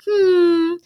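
a pointed put-upon sigh from the mother-in-law; imitating a mother-in-law's loaded sigh; passive aggressive sigh. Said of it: "And then would go".

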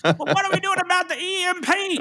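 very high laughing voice; laughing mockery. Real, not acted. Mocking angry listener emails while laughing, very high. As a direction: laugh through a squeaky mock complaint.

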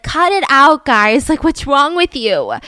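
loud, mock-exasperated teen objection; retold exasperated protest. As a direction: act out telling them off, ending slow.

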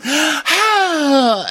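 high hammed-up cry; mock wail. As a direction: wail at the sky like a bad actress. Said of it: "and then Rinna goes".

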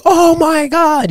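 high, slow, stretched-out disbelief; mock amazement. Both riders ride down after landing, amazed. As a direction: draw it out, awestruck, as a retold reaction.

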